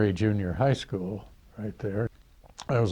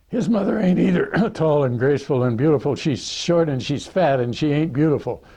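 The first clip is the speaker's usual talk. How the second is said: higher, louder jeering put-down; mocking kid; 5 semitones higher, 6 dB louder than usual. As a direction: rib your buddy in front of the others. Another kid mocking Billy's mother.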